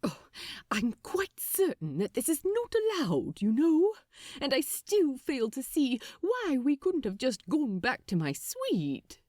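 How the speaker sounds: lightly voiced prim angel, half-disapproving; frowning angel. A narrator's reading. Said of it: "frowned Aziraphale".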